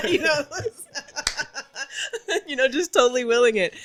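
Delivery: laughing as she owns up to willing it. Real, not acted. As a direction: giggle while you admit it. Laughing at her own manifesting.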